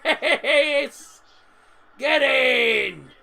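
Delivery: loud happy cheering; excited cheer. Real, not acted. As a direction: celebrate the goal loudly. Celebrating the goal.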